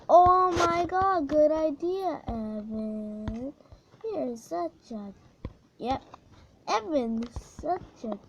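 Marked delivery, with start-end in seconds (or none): 0.1-2.2 louder, slow delighted cry; excited shout. Real, not acted. Gamer cheering his own idea, excited. Praising himself loudly.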